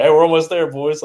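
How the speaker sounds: loud, high shout back; calling out. Retold or imitated. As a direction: holler reassurance to the team, retold. Calling back to hollering players.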